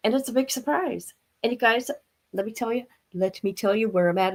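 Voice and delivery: ordinary delivery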